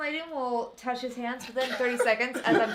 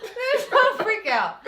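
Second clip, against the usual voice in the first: laughing; 10 semitones higher, 10 dB louder than usual. Real, not acted. Echoing denial while laughing.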